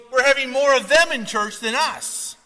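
indignant deacon. Retold or imitated. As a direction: alarm at the newcomers, voiced up.

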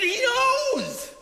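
high, loud cry, stretched out; exasperated insistence. Retold or imitated. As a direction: retell it as an emphatic shout. Retelling own insistence to woman.

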